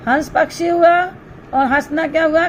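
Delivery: normal talking voice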